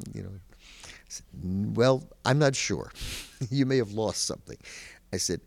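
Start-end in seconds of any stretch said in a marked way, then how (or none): 1.4-4.6 amused reply. Retold or imitated. Caller answers joke, laughing.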